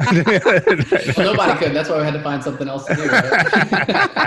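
laughing through a joke; chuckling tease, words breaking into laughs. Real, not acted. Joking reply, laughing.

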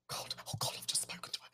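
hushed, voiceless aside in mock horror; panicked whisper. Hairdresser realising he has spoken to the Queen, panicked whisper. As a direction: mimic whispering to yourself after a blunder.

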